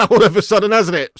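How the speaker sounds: loud, high words riding on a laugh; amused laughing. Real, not acted. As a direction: crack up while talking. Laughing at chat turning to cooking.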